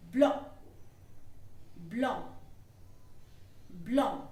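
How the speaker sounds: blanc with a nasal "blon" and no final c. Shown as a demonstration. Teacher drilling blanc with its nasal vowel. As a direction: let the vowel of blanc ring through the nose. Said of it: "There will be a little nasal sound".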